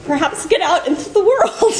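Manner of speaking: playful high imitation broken by laughs; sponsor's nudging advice. Mocking her sponsor telling her to get dressed, laughing. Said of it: "she'll say".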